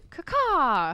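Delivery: loud, high bird call caw; eagle caw. Pretend. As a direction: screech it like an eagle mascot. Eagle cheer after crow call.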